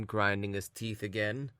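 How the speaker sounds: ordinary delivery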